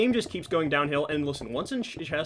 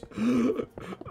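high, shuddering mock gasp; hammed-up tearful gasp; imitated sobbing gasp; 9 semitones higher than usual. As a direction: act out a crying kid catching breath. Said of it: "and he goes".

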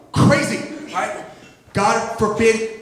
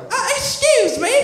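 very high-pitched English patron; high, put-on English customer voice; English bar customer; 13 semitones higher than usual.

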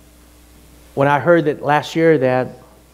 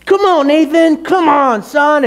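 high, loud exasperated urging; exasperated parent at the end of his rope; frustrated pleading; 14 semitones higher, 5 dB louder than usual. Retold or imitated. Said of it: "I would get so frustrated".

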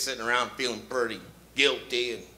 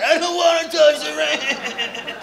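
put-on high, slow, whining child; whiny kid voice; 7 semitones higher, 4 dB louder than usual. Billy refusing to touch grandpa, high whiny wail. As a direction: whine like a stubborn little kid. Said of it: "Remember that voice as a kid?".

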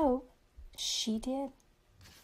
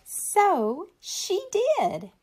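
very slow, lifted payoff line; slow, high payoff; drawn-out payoff; 8 semitones higher, much slower than usual. A narrator's reading. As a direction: stretch each word for the kids.

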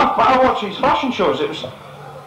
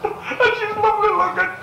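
crying. Acted. Old man boasting of his new wife, mock sobbing. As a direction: sob it out like a hammed-up old man. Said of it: "He's sitting there crying".

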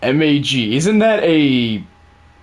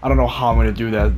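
ordinary delivery

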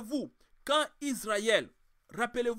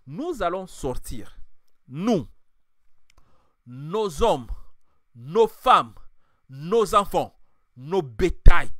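starts level, builds much louder on each item; Moses's defiant reply, swelling louder; 10 dB louder than usual. Retold or imitated. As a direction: defy Pharaoh, piling up the list. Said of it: "Moïse a dit à Pharaon".

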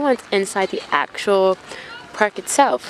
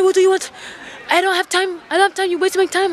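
rude shopkeeper; 10 semitones higher, faster than usual. Retold or imitated. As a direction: imitate an irate shopkeeper brushing off a customer. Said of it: "has been super rude".

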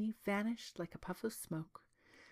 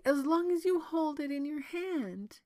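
high-pitched princess voice; high, wary princess protest; distrustful princess; 7 semitones higher than usual. A narrator's reading. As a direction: press the objection in a raised voice. Princess continues her objection.

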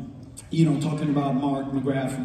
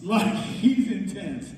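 ordinary delivery

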